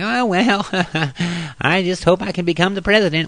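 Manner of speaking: high, timid little voice, mocking Ron Paul; wimpy voice. Mocking Ron Paul's voice as too weak for president, high and timid. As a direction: play him as a meek weakling. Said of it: "He's got a little wimpy voice".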